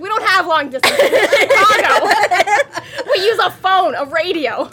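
can barely get the words out for laughing; a real laugh breaking into the quote; laughing through a quote. Real, not acted. Quoting the cop while cracking up.

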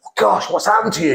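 shocked concern. Retold or imitated. Acts own shocked reaction.

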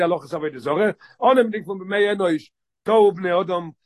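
usual speaking voice

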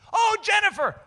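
high, loud, delighted exclamation; gushing greeting. Retold or imitated. Favorite teacher spotting a former student, gushing. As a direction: imitate a teacher thrilled to see her.

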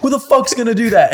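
high-pitched mock outrage; Hector's indignant challenge. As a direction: imitate a player daring anyone to try. Retelling Hector's angry challenge.

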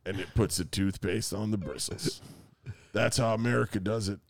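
lightly voiced cowboy; cowboy narrator. Continues Sam Elliott bit.

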